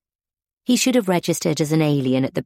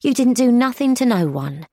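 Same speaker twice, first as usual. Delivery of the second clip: indignant; 7 semitones higher, 3 dB louder than usual. A narrator's reading. Alice defends Gunther indignantly.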